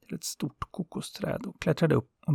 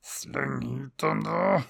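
slow, drawn-out monster order; the sun demanding the coconuts, slow and gruff; 2 semitones higher, much slower than usual. Acted. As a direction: bark it out slowly like the greedy sun. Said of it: "och sa".

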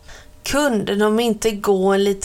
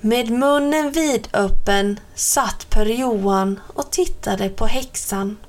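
usual speaking voice

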